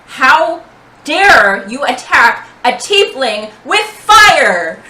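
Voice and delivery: booming, drawn-out, outraged shout; intimidating shout. Acted. Tiefling intimidating the gnome who attacked her, booming shout. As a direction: make your voice boom, slow and menacing. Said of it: "I'm going to shout at him".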